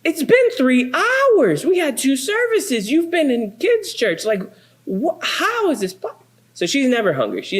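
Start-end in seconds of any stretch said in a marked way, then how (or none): 0.0-6.2 a dad who can't believe it; exasperated disbelief. Retold or imitated. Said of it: "And it's like".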